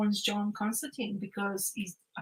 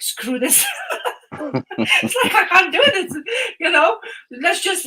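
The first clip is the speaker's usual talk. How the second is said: laughing it out; 8 semitones higher, 5 dB louder than usual. Retold or imitated. Priest loses it at funeral.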